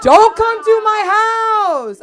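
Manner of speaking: very high, very loud comic yell; outraged shout. Acted. Woman warns off her ex from the house, shouting. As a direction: shriek a warning to keep away. Shouted rejection of ex's gesture.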